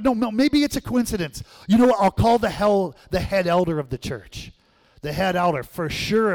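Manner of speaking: high, loud, frantic reasoning; frantic hope. Retold or imitated. Frightened boy decides to call the head elder, frantic and loud. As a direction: talk yourself out of panic, fast and high.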